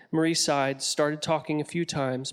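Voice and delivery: plain reading voice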